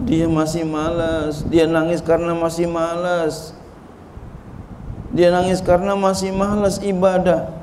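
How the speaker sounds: insistent preacher, building in pitch and force; emphatic preaching. Real, not acted. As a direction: hammer each repeated phrase home, rising as it goes.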